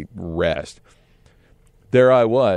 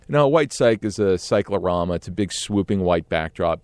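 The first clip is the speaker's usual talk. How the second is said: plain reading voice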